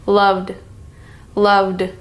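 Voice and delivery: "loved" ending in a voiced "d". Shown as a demonstration. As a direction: end "loved" on a voiced "d" with no air. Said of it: "end with the D sound".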